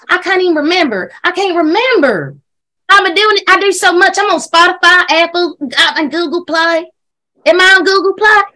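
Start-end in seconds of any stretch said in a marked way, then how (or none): none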